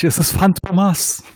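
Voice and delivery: high theatrical unmasking cry; dramatic reveal voice. Acted. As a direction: announce the culprit like a cartoon hero. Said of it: "Und dann sag ich".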